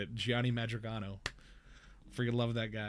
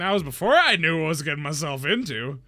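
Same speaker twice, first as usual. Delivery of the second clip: louder, smug retort; smug reply; 3 semitones higher, 10 dB louder than usual. Retold or imitated. Voice actor answering the cheated client, louder smug retort. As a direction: lean back and gloat.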